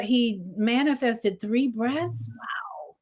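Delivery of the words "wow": awed whisper. Real, not acted. Awed reaction to shared story.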